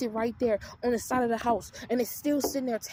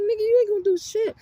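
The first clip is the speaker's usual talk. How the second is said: loud, high defiant taunt; defiant retort; 9 semitones higher, 8 dB louder than usual. Retold or imitated. Sister loudly daring her brother to act. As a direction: throw it back at him loud.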